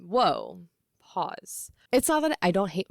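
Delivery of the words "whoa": ordinary talk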